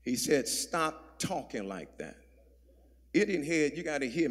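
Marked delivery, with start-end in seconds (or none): 0.5-2.2 slow, low, very soft reprimand; hushed rebuke. Retold or imitated. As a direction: imitate a private, deliberate telling-off. Peter's private rebuke repeated.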